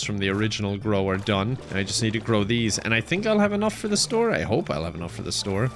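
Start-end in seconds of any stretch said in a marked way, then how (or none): 2.8-4.3 optimistic guess. Real, not acted. First take, then corrected to hope. 4.3-5.7 doubtful hope. Real, not acted. Restated lower, less sure.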